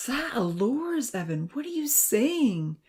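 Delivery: high, slow, dismayed disbelief. Acted. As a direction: voice rising in shocked dismay. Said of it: "the professor replied with dismay".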